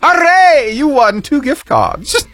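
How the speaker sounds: high, hammed-up British cheer; British accent. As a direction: celebrate in a soaring mock-British voice. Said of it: "I was able to hold it that long".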